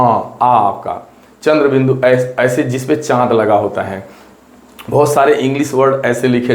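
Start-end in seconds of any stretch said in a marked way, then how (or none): 0.0-1.0 "आ" stretched very slowly; drawn-out aa. Shown as a demonstration. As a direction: hold "आ" long and slow. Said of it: "जैसे आ". ("आ" को धीरे-धीरे लंबा खींचें)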